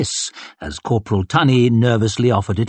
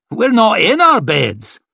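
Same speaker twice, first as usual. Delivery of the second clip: high, raised-voice correction; exasperated retort; 11 semitones higher, 4 dB louder than usual. A narrator's reading. Onlooker corrects the panicked one, exasperated retort. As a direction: call back over the panic. Another answers the panicked cry.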